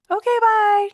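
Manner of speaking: a joking fake farewell; mock sign-off. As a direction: pretend to end the show suddenly. Fake sign-off, then just kidding.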